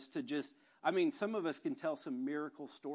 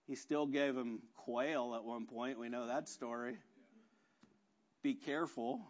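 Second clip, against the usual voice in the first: usual speaking voice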